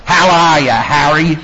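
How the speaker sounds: high, loud leering "how are you"; imitating a nasty stranger's taunting hello; menacing mock greeting. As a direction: lean in and sneer the greeting. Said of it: "Like this".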